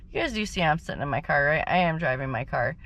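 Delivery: normal talking voice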